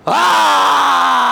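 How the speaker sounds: long imitated lion roar. Cub finds his lion self, long roar. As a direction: roar out full and sustained. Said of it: "he lets out a roar".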